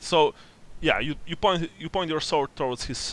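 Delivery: ordinary talk, nothing marked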